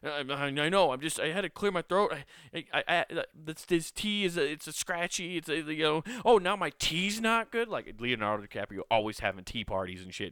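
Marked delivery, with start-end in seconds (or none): 0.0-7.7 higher, stammering mock apology; flustered guest. Guest apologizing to DiCaprio for clearing throat, flustered. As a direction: fumble the excuse nervously. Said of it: "he's just like".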